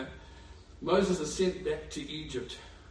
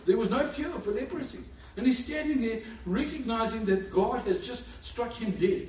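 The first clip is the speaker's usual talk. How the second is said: ordinary talk, nothing marked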